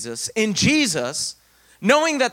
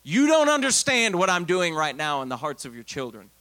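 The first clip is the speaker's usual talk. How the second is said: ordinary talk, nothing marked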